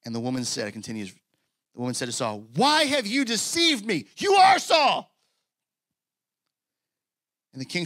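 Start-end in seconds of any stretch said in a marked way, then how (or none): 2.5-5.2 high, loud shout of accusation; terrified scream. Acted. Woman medium to Saul on discovering his deceit, screaming. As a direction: cry out in shocked alarm, drawing out the words. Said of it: "So this woman screams".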